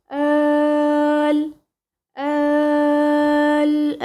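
آل- held very long in recitation, with no stress; drawn-out long vowel. Shown as a demonstration. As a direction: stretch the آل- out evenly over six counts. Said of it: "هنا بقى ما عملتش نبر أنا".

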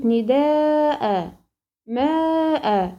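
very slow نداء and ماء, each closing on a held "aa" after the hamza; compensatory lengthening. Shown as a demonstration. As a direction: stretch the long vowels in نداء، ماء and drop the "n". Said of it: "هيجيلي مد عوض عن التنوين حركتين".

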